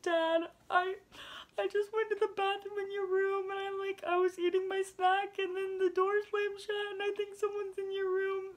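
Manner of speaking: high, loud crying plea; retold bawling. Teen on the phone to her dad, high crying plea. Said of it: "I'm bawling".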